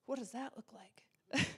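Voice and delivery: slow whispered question; hushed puzzlement. Retold or imitated. Woman answering God's command, hushed puzzled whisper. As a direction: whisper it, at a loss.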